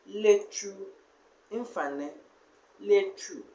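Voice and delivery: "Lechu" said slowly, the chu stressed; slow demo word. Shown as a demonstration. Teacher sounding out "Lechu" so students hear the chu. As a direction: stretch out "Lechu" so the chu stands out. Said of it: "So you see how the chu is spelled".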